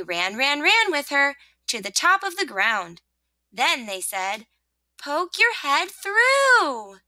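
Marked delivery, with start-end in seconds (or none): 5.0-7.0 high, slow, drawn-out call; urging little voices. Acted. Sun and rain to rosebud, urging. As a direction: call it up high, stretching the end.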